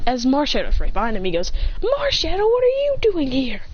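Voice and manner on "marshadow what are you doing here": high-pitched startled outcry; high acted surprise; alarmed question. A narrator's reading. Startled by Marshadow.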